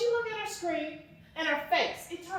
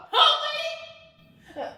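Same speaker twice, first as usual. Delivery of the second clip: slow, much higher, laughing scorn; bitter laugh; 10 semitones higher, 4 dB louder, much slower than usual. Acted. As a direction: laugh it at her in disbelief. Mocking laugh at clerk.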